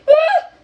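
short high loud scream; startled scream. Real, not acted. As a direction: yelp in fright. Scream in horror game.

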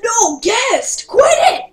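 loud, high scolding yell; shouted protest. Real, not acted. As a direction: yell at them to stop. Protesting at guest jumping on them.

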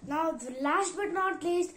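ordinary talk, nothing marked

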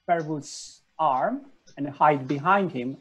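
usual speaking voice

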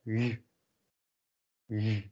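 softened southern G sound; soft Dutch G. Shown as a demonstration. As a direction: say the G gently, close to a "y". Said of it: "This is the soft G we call it".

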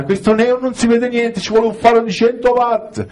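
mock wife yell. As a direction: fake a wife shouting from the mirror. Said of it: "La senti gridare". (strillo finto della moglie, acuto ma non forte)

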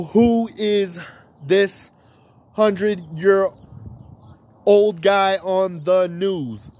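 broken up word by word, slowing to the end; slow halting question; slow dramatic pauses. Shown as a demonstration. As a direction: pause between each word, drag the last. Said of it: "You shake the camera a little bit".

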